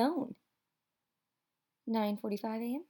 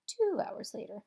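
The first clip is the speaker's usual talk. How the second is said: usual speaking voice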